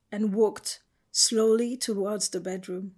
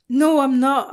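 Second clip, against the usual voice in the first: loud, higher yell of denial; yelling back; 5 semitones higher, 10 dB louder than usual. A narrator's reading. Jess answers Teresa's accusation, yelling back loud. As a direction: throw the denial right back at her. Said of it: "I yelled back at her".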